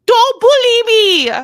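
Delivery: gasp, then a high loud slow wail of protest; mock wounded cry. Co-host teased, hammed-up high loud protest. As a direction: overplay being hurt. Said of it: "gasps after teasing".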